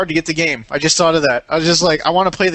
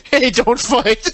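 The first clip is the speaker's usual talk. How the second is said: drawn-out, higher bystander plea; cafe bystander; 6 semitones higher, much slower than usual. Pretend. Cafe guy to two brawlers, slow mock plea. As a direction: play a hapless guy breaking up a fight. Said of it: "Some guy at the cafe".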